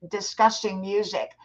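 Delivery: normal talking voice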